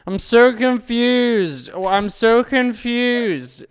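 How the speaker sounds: high, loud, drawn-out groggy wail; slow, high-pitched bewildered moaning; confused wailing. Retold or imitated. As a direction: wail it slowly, lost and bewildered. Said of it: "waking up just being like".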